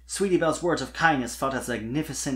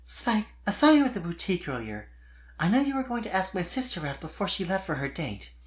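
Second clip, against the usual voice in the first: much higher giggly filly voice; Sweetie Belle voiced; 6 semitones higher than usual. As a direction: play a bright young unicorn filly, teasing kindly.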